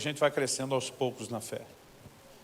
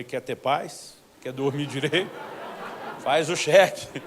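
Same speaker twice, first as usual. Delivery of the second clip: firm push; 8 dB louder, slower than usual. Retold or imitated. Pastor pressing the brother.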